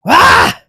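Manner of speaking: loud, raw scream of rage; real angry scream at the screen; frustrated yell. Real, not acted. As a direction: let out a furious shout. Mid-rant frustrated outburst.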